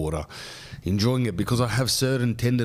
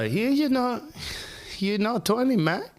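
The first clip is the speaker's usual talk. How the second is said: much higher, hammed-up Bulgarian doctor; a doctor with an overdone Bulgarian accent, pitched way up; mock Bulgarian accent. Doctor tells the podcaster he's not twenty, in a mock Bulgarian accent. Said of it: "it's Bulgarian".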